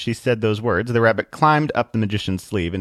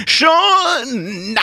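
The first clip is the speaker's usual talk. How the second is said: high, drawn-out preacher's shout; 6 dB louder than usual. Acted. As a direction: cut the argument off with a long cry. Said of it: "Atheist shouted".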